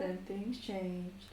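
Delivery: very slow and soft; slow dramatic. Pretend. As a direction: drag the words out for drama. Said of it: "That's a pretty good impression".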